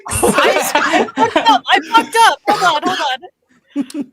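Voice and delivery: real high, loud, laughing panic; laughing yelp at your own blunder; flustered laughing. Real, not acted. Flustered by own streaming mistake, laughing.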